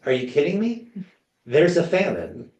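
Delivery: imitated disbelief, pitched high; incredulous retort. Widow answers a stranger wanting food in famine, incredulous. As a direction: act out an indignant, high-pitched comeback.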